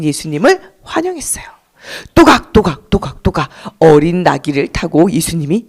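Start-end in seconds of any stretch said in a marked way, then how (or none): 2.1-3.5 lightly clopping donkey hooves, spoken; hoofbeat imitation. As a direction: sound out the donkey's trotting steps.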